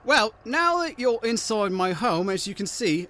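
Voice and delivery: high sweet-voiced hag host; a hag welcoming a guest in a high voice. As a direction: play the hag, pitched high and inviting.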